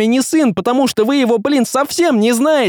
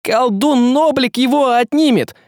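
high, urgent cry; shouted; 13 semitones higher, 4 dB louder than usual. A narrator's reading. Young man warning about the sorcerer, shouting. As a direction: call out the warning at high pitch. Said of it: "закричал он".

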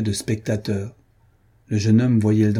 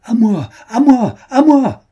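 high, loud desperate call; strident cry for help; 13 semitones higher, 10 dB louder than usual. A narrator's reading. Duchess calling the ballroom for rescue, shrill desperate shout. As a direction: scream for help across the ballroom. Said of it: "d'une voix stridente ... cria-t-elle".

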